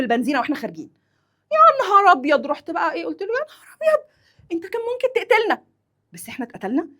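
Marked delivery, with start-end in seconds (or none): 1.5-2.5 high, loud cry of mock alarm. Wife reacting to cigarette thrown at petrol station, alarmed. 3.3-5.6 very high, hurried mock alarm; shriek-pitched acted reproach; alarmed scolding. Wife scolding husband who nearly killed them, alarmed.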